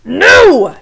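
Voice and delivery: loud, high shouted no. Acted. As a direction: bellow the no. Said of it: "shouted the head mouse again".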